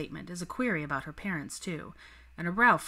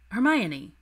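Hermione called out louder with a falling end; beckoning call; 5 semitones higher, 7 dB louder than usual. Acted. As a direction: call her name across the room. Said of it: "he beckoned".

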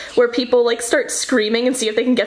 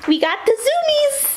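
much higher, thrilled sing-song; excited squeal; 13 semitones higher than usual. Real, not acted. Owner cheering her dog's zoomies, high thrilled squeal.

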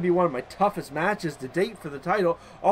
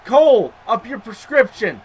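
slow, high, loud rebuke; indignant scolding; 7 semitones higher, 9 dB louder, slower than usual. Real, not acted. Player scolding a commentator who misjudged, loud indignant rebuke. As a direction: tell off the commentator, drawing it out.

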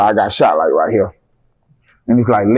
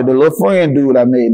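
usual speaking voice

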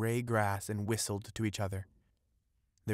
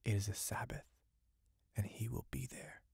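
soft, secret whisper, fading; 7 dB quieter than usual. Acted. Witch whispering of the Sabbath to the fisherman. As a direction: hush it like a dark secret. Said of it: "she whispered".

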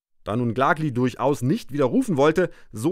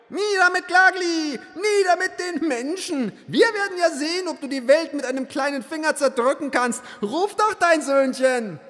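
jeering mob. Acted. Mob jeers at the narrator.

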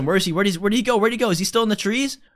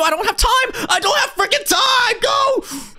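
high frantic yell; panicked shouting; 11 semitones higher, slower than usual. Real, not acted. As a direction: yell it while running for your life. Running from monster.

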